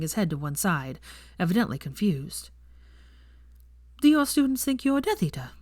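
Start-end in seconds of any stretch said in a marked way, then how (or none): 4.0-5.5 blunt question. Acted. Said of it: "she asked bluntly".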